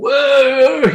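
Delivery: high drawn-out whoa, imitating a cheer; mock group cheer, pitched right up. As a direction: whoop like the lads cheering. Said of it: "doing all the cheering".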